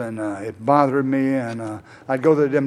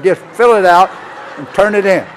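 higher and louder, pushing the point hard; forceful urging; 6 semitones higher, 9 dB louder than usual. Retold or imitated. Sponsor urging the man to list every arrest, forceful and loud. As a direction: drive it home like a sponsor who won't budge.

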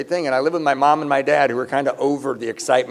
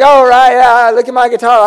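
high, loud sarcastic sing-song; mock your own clumsy lines; mocking self-parody; 7 semitones higher, 9 dB louder than usual. Mocking his own pitch to her.

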